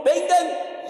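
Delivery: high, loud order to the troops; sharp shouted command from the centurion; barked command. Acted. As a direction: call them in like a captain. Centurion commanding his soldiers.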